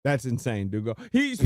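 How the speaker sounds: ordinary talk, nothing marked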